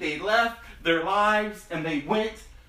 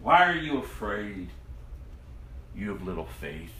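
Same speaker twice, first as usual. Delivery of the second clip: Jesus gently questioning his frightened disciples; gentle rebuke; 7 semitones lower than usual. Acted.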